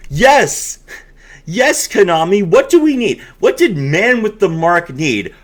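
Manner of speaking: overjoyed fake praise, dripping sarcasm; sarcastic mock excitement. Real, not acted. Presenter mocking Konami for a reprinted card, sarcastic mock cheer.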